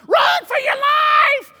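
high, slow, hammed-up panicked shout; mock weatherman yelling a warning on television, hammed-up panic. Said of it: "Panic Payne is sitting there on television going".

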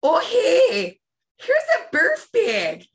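high, loud mom impression with stretched vowels; Jackie. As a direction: pipe up as an exaggerated Midwestern mother. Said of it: "talk like our mother".

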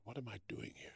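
hushed, soft question to himself; whispered thought. Retold or imitated. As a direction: barely voice it, to yourself. Inner thought at the hotel.

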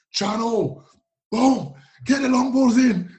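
high, loud mock coach yelling long-ball orders; hammed-up shouty English coach; Cambridge coach barking orders. Coach yelling tactics at the player, loud mock shout. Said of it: "and it's like".

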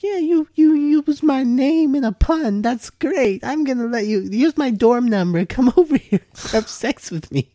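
very high, squeaky mock girl voice; mock hookup girl. Mocking hookup culture to his friend, very high squeaky voice. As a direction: squeal it like a giggly college girl. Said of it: "hookup culture is all like".

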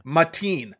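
ordinary talk

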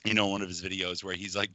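ordinary talk

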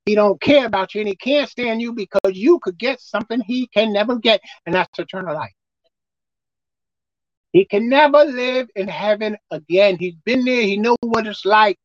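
usual speaking voice

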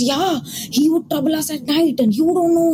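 a lightly voiced scared tenant sharing gossip; spooked Russian tenant. Russian tenant warns the newcomer about the ghost, lightly spooked voice. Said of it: "He goes".

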